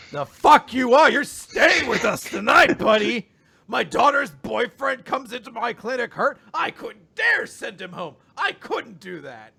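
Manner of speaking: loud, high-pitched blustering dad; mock indignant dad shout. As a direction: boom it out like an overprotective host. Dad insists the patient stays.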